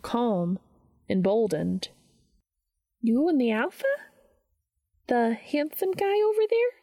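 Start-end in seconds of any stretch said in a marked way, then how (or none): 3.0-6.7 questions climbing ever higher, less sure; growing uncertainty. A narrator's reading. Donna asks Dean about Cass, increasingly uncertain. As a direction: start fairly sure, then climb high into doubt. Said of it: "Donna sounded less certain the more she spoke".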